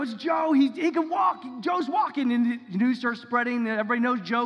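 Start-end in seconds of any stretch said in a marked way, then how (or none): 0.0-2.3 much higher, louder crowd shout; excited shouting. Retold or imitated. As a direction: yell the news to everyone around. Crowd shouts beggar can walk.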